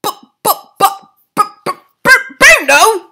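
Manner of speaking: high, loud stuttering; shocked father; stammering. A narrator's reading. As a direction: trip over the words. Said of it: "stammered Mister Jenkins again".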